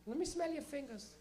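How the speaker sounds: high, slow Portuguese mom voice; Portuguese parent. Retold or imitated. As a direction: demand it as a suspicious Portuguese mother. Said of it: "have your parents check your fingers".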